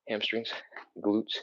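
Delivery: usual speaking voice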